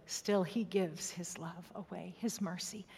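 slow hushed whisper; whispered devotion. Real, not acted. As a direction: let it fall to a whisper, slowly. Quiet sermon moment, whispered.

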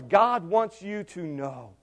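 normal talking voice

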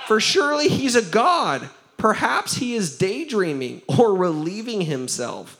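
high, emphatic mock-serious taunt. A narrator's reading. Elijah ridiculing the prophets' god, mocking. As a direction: taunt with exaggerated high swoops. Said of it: "Elijah began mocking them".

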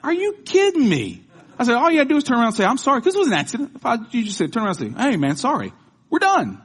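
high, loud exasperated scolding. Retold or imitated. Father scolding his son over the accident, exasperated and loud.

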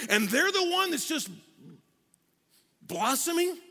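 indignant complaint. Acted. Business owner going bankrupt complaining to God, indignant. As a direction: protest in disbelief at the unfairness. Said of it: "we say it like this".

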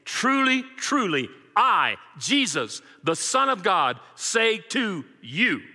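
each phrase weighed out slowly and firmly; commanding Jesus. Acted. Preacher voicing Jesus speaking with authority, slow and commanding. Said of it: "He is emphatic. He is authoritative. He is commanding".

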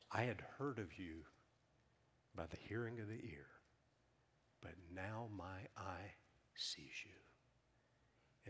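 fading to a breathy whisper at the end; hushed awe. Acted. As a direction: let Job's confession sink to a whisper. Job's awed words to God.

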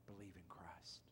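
hushed whisper of belief; soft intimate whisper; whispered plea. Acted. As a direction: whisper it like a promise. Soft plea after Believe in God.

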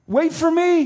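very high, loud desperate call; crying out. Acted. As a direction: call after them desperately. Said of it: "he cried".

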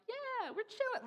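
high, goofy mock-casual voice; laid-back snake chat. Preacher voices a kid with a wild snake, goofy and casual. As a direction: put on a high, carefree little voice. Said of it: "it's just like".

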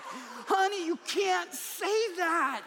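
half-laughing, scold her in shock; shocked protest. Retold or imitated. Husband to wife at the book table, laughing shocked protest. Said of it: "I was like".

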